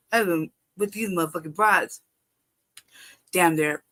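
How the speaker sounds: usual speaking voice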